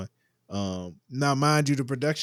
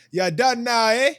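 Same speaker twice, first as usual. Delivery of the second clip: hammed-up British accent, much higher and louder; bad British accent; 12 semitones higher, 7 dB louder than usual. Host tries a British accent, badly, on his podcast co-hosts. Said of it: "That was a horrible accent".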